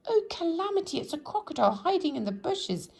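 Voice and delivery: panicked shrieking. A narrator's reading. Mister Jelly imagines a crocodile in the bushes, shrieking high. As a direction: spiral into wilder fears. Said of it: "he shrieked".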